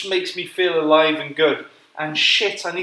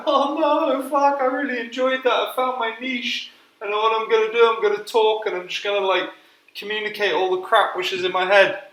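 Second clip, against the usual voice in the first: mock excited; 7 semitones higher than usual. Retelling his excited hopes.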